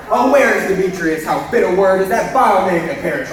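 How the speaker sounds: loud, high, swept away by love; ecstatic loud declaration. Acted. Lysander waking, enchanted, vehement.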